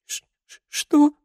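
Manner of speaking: breathless stammer then high cry; sobbing. A narrator's reading. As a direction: sob the question out. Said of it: "всхлипнула девушка".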